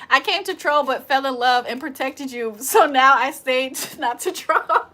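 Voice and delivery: ordinary delivery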